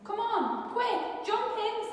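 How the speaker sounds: high, eager rushing call; urgent hurry. Acted. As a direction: hurry Mouse into the boat. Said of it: "said Bear".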